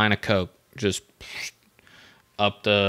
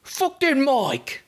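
very high, drawn-out bad Irish accent; Conor McGregor; 13 semitones higher, much slower than usual. Comic cursing the mic, bad Irish accent. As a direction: squeal it in a terrible Irish brogue. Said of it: "That was the worst Irish accent".

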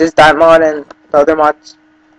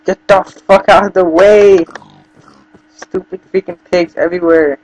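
loud, slow furious yell; berserk rage; 3 semitones higher, 4 dB louder, slower than usual. Real, not acted. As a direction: roar it at the pigs, dragging it out. Raging at pigs blocking him.

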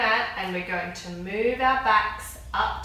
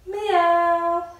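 put-on high, louder meow for the kids; loud cat meow; 7 semitones higher, 7 dB louder than usual. Shown as a demonstration. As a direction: belt out a playful cat meow. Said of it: "make the cat noise".

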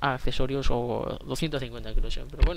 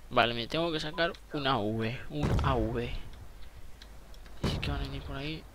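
ordinary delivery

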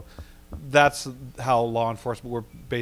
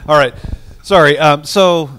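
louder, projecting to the room; speaking up louder; 4 semitones higher, 13 dB louder than usual. Real, not acted. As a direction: push the voice out so the back row hears. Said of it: "I always think I have a louder voice than I do".

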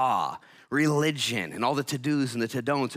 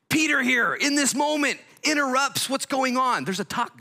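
1 preaching peak; 6 dB louder than usual. Real, not acted.

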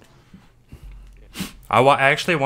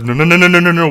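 higher, louder string of no's; loud frantic no's; 5 semitones higher, 10 dB louder, faster than usual. Retold or imitated. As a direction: shout the no's fast and frantic. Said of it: "I do that voice".

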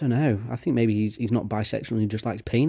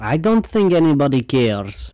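loud, slow, mocking shrug; high, loud sarcastic drawl; sarcastic dismissal; 4 semitones higher, 7 dB louder than usual. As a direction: drag it out with scorn.

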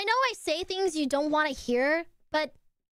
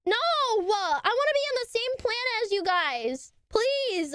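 high, whiny mock begging; hammed-up childish begging; mock pleading; 6 semitones higher than usual. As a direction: plead playfully to be let in, pitched up. Begs not to be excluded.

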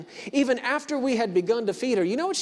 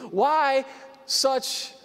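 ordinary talk

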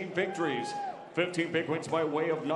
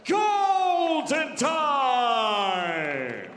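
very slow, stretched, loud call; drawn-out shout; 7 semitones higher, 6 dB louder, much slower than usual. Acted. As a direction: hold every sound like an arena call. Announcer's drawn-out catchphrase.

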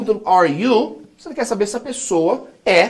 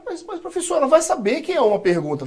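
high, softer protesting pupil voice; objecting student; 5 semitones higher, 4 dB quieter than usual. Acted. Teacher imitating a student querying the rule, high-pitched objection.

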